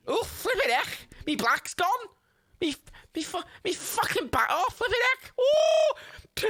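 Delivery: very high hammed-up Scouse wailing; Scouse Jimmy. Scrapper whose back has gone, mock-pained Scouse squeal. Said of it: "how do people speak like that".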